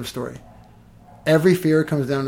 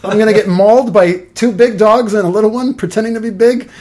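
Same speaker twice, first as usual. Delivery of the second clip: mock panic; 4 semitones higher, 7 dB louder than usual. Retold panic facing charging dogs.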